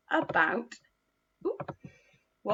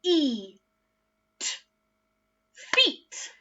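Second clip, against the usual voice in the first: "E, t" separate, then "feet" swept up higher; sound then blend; 6 semitones higher, much slower than usual. Shown as a demonstration. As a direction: segment then blend upward. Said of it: "Let's do that one more time together".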